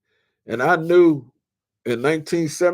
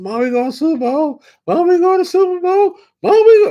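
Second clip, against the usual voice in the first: rising from high to very high, louder each time; a boy yelling the news to his mom; excited shouting; 9 dB louder than usual. Retold or imitated. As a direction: build to a thrilled yell. Retold boyish excitement building.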